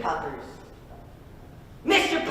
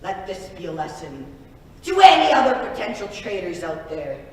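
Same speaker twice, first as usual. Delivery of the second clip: usual speaking voice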